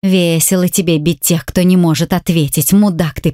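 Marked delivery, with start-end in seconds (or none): none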